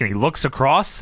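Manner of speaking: ordinary delivery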